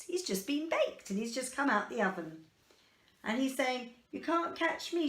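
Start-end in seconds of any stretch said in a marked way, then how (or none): 4.1-5.1 sing-song taunt, pitched up; higher taunting gingerbread man. Acted. Gingerbread man taunting everyone chasing him, higher. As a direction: tease the chasers from afar.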